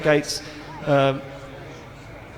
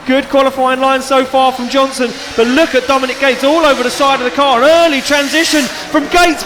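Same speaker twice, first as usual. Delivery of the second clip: excited commentator calling the action; loud, high play-by-play; live play-by-play; 9 semitones higher, 8 dB louder than usual. Real, not acted.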